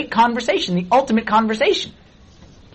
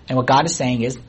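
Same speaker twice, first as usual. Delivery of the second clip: normal talking voice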